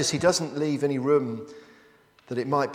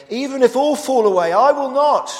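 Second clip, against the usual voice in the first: loud, high and defiant, every word thrown out; bold declaration; 14 semitones higher, 13 dB louder than usual. Acted. Preacher voicing Peter's boast to the congregation, loud and high. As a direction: Peter vowing he will never fall away, far above his own pitch. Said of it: "Peter declared".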